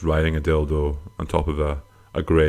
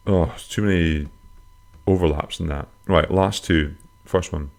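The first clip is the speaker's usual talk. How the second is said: ordinary talk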